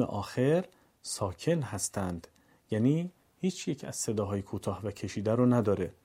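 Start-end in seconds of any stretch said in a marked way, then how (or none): none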